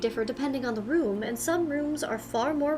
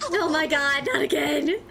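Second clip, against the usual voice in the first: louder, exasperated through laughter; laughing; 2 semitones higher, 4 dB louder than usual. Real, not acted. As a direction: groan it while laughing.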